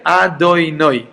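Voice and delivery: "Adonai" drawn out slowly as A-do-NOY; final-syllable stress. Shown as a demonstration. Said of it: "with the emphasis in, on the nun at the end".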